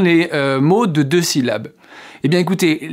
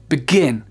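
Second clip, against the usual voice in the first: "begin" as a quick "b'GIN"; barely touch "be", hit "gin"; second-syllable stress. Shown as a demonstration. Teacher repeating the right form, first syllable of "begin" reduced. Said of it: "on n'entend presque pas le B".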